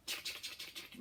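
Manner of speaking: soft unvoiced clicky chick, chick; whispered chick noise. Shown as a demonstration. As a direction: tick it out in a whisper. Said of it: "Make that chick, chick noise".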